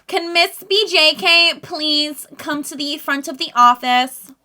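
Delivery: high, slow intercom page; office announcer. Acted. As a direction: call her to the office.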